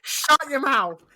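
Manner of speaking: loud mock scold. Mock telling off.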